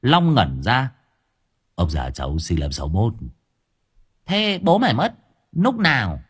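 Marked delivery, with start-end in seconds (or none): 1.7-3.1 low, subdued male voice; dazed man answering. A narrator's reading. Long answers. 4.3-6.2 put-on high, gleeful old granny voice, pitched up; high old woman mocking with glee; cackling old woman. A narrator's reading. Old woman questions Long.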